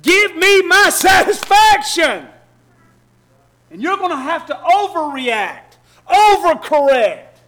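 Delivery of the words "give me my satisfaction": loud, high, slow demanding shout; screaming flesh. Retold or imitated. Preacher voicing the flesh screaming for satisfaction, shouted. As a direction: roar out the flesh's craving. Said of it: "your flesh is screaming".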